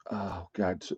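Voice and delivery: breathy whisper under the breath; soft unvoiced groan of frustration; whispered oh God. Real, not acted. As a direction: whisper it to yourself in dismay. Forgets a channel name.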